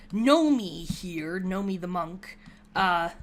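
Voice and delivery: ordinary talk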